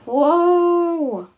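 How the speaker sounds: loud, high stunned whoa; shocked whoa. Acted. Lily meeting mum's new boyfriend, loud stunned whoa. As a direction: blurt it out in disbelief. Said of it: "Lily's like".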